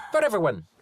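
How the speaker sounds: put-on the butcher shouts at Zoe, thick accent; loud thick accent. A narrator's reading. Butcher repeats insistently.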